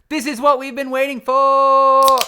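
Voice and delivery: high, loud, stretched-out cry; over-the-top triumphant holler; hyped-up yell. Pretend. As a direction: belt it out and hold the last word. Hyped moment before opening beer.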